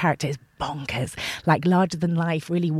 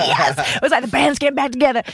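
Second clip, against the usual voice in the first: excited; 8 semitones higher, 7 dB louder than usual. Retold or imitated. Cast reunion joy.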